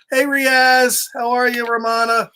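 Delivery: plain reading voice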